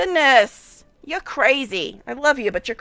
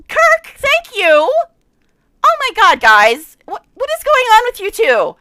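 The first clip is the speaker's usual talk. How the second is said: loud, high, thrilled thanks; overwhelmed excitement; 7 semitones higher, 10 dB louder, slower than usual. Real, not acted. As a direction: gush with real delight.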